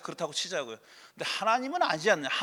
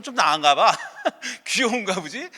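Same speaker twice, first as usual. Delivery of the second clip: scoffing laugh; 4 dB louder than usual. Real, not acted. Mocking the puppy theory.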